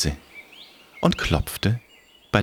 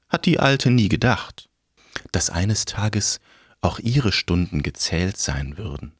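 plain reading voice

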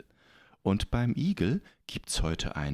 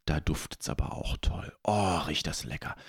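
whispering; 5 semitones lower than usual. Acted. Smelling food from trees.

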